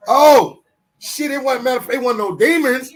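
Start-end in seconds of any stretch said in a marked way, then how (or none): none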